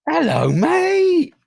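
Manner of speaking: very high, drawn-out hello; drawn-out greeting. Real, not acted. Host hailing his guest as he joins, drawn-out high greeting. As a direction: stretch it out high and slow.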